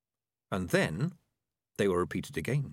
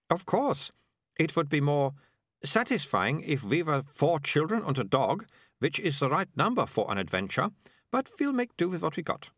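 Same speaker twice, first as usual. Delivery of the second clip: put-on high, particular girl's voice; fussy girl, higher throughout; critical Melicia; 8 semitones higher than usual. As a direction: judge the team, pitched up. Narrator plays critical Melicia.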